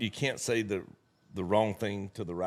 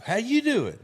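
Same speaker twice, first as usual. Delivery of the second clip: much higher, loud chipper greeting; Joe Cheery; 13 semitones higher, 7 dB louder than usual. Retold or imitated. Young guy at the airport bar greets him, chipper and loud. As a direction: bounce in like an overly cheery kid. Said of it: "Joe Cheery".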